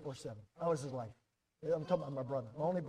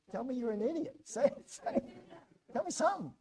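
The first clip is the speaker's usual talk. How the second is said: laughing exasperation; 8 semitones higher than usual. Retold or imitated. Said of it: "I said".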